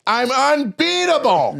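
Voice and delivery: loud, high, drawn-out proclamation; imitated shouted boast. Poker opponent answering another all-in, high shouted mock boast. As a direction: bellow it high, stretching every syllable.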